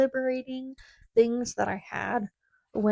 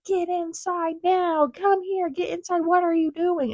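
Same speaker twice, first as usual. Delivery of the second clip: retold yell; 8 semitones higher than usual. Professor yelling at student to come inside, urgent high call. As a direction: bark orders at a student outside. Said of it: "starts yelling at me".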